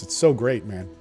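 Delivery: usual speaking voice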